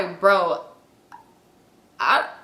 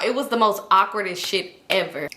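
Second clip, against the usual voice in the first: ordinary talk, nothing marked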